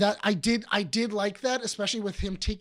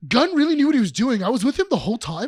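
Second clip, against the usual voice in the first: louder, higher gushing fan; fake fan gushing; 6 semitones higher, 7 dB louder than usual. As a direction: gush like a bandwagon fan. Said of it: "It's the same people".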